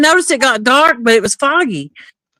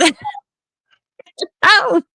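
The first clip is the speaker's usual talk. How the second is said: breaking into laughter mid-sentence; real laughing; 9 semitones higher than usual. Real, not acted. Driver admits practising the sobriety test at home, laughing. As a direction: crack up on the last words, pitch jumping up. Cracks up telling own excuse.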